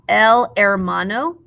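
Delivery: "hermano" said with no H sound; "hermano" opening straight on its vowel; silent H. Shown as a demonstration. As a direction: drop the H, starting "hermano" on the E. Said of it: "The H is silent".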